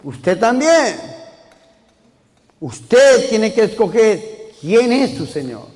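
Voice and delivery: fervent pulpit preaching; preaching at full force. Acted. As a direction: preach it to the congregation with fervor. Preacher raises his sermon.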